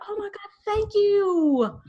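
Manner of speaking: high, drawn-out mock-delighted squeal; gushing thanks. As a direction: gush at a compliment, slow and high. Acts out delighted reply to flattery.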